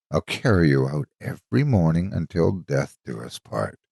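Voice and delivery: plain reading voice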